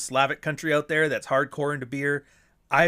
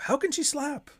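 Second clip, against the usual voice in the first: pitched way up in a mocking squeak; high mock question; 13 semitones higher than usual. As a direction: repeat it as a high, mocking parody.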